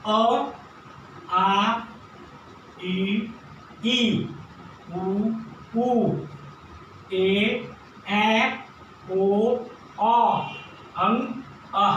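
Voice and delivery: each vowel called out clearly and slowly; vowel drill. Shown as a demonstration. Teacher leading children through the vowel sounds. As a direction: chant the vowels one by one for the class. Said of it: "अब direct कैसे पढ़ना है वो देखो".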